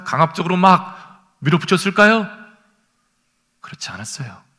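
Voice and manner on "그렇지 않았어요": hushed whisper. Real, not acted. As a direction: drop to a whisper. Quiet denial after mock commands. (속삭이듯)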